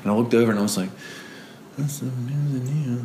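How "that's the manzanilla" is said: softer, slow dawning dread; stunned realization. Retold or imitated. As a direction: murmur it as it sinks in.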